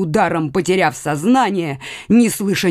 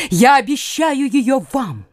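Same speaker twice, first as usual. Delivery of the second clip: usual speaking voice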